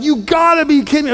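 high, incredulous protest; can't-believe-it whine; shocked disbelief. Acted. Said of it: "He's shocked".

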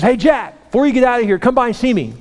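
high, projected coach call. Retold or imitated. Coach calls Jack before he leaves, calling out. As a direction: hail the player across the room.

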